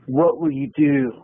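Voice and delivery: flat surfer-cool Keanu delivery; Keanu Reeves impression. Comic asks the crowd like Keanu Reeves. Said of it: "That's very Keanu Reeves".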